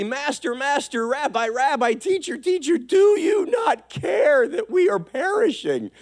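high-pitched, hammed-up frantic pleading; drama queen panic. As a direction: cry out like melodramatic disciples freaking out. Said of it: "the drama queen anointing. They start freaking out".

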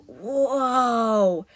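long drawn-out tourist whoa; amazed whoa. Acted. Crowd of tourists reacts in amazement.

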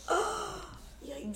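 high gasping yikes; gasp, then squeak out yikes; shocked yikes. Retold or imitated. Mock reaction with gasp.

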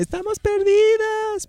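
high, slow mock quote of the video; high, drawn-out imitation of a viral clip; imitating the viral video. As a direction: stretch it out very high like the meme. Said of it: "ese video de Estamos Perdidas".

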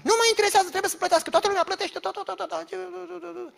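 high-pitched caricature of an uncaring landlord; comic high-pitched phone rant. Acted. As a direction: rattle on in a high voice, fading into babble.

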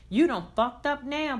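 high, loud gloating taunt; taunting jab. Acted. Patricia taunting the groom as she leaves, loud and gloating. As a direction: rub it in his face, loudly. Patricia mocks Milton leaving room.

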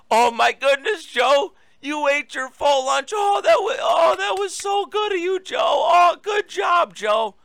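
mocking sing-song praise for a toddler; mock baby talk. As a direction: coo over him like a proud parent with a little kid. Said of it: "treating the president of the United States like he's a toddler".

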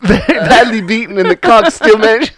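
laughing, put-on British accent; imitating a British man. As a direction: mimic the British guy while cracking up. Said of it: "He's like".

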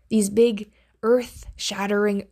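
normal talking voice

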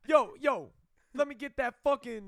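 mock delivery guy. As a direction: bark it like a pushy delivery bro. Same mock character.

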